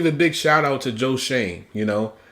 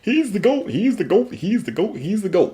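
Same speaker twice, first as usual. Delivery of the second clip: mocking the fans; 7 semitones higher than usual. Mocking doubters who called him the goat, in a high sing-song. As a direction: parrot the hype fans, starting high and coming back down. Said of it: "Everyone that's saying".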